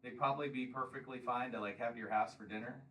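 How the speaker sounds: ordinary delivery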